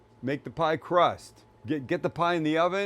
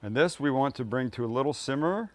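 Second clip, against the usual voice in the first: ordinary talk, nothing marked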